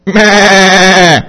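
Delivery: put-on loud, bleating old goat. Old goat teacher greets the class with a loud bleat. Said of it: "clears his throat, and says".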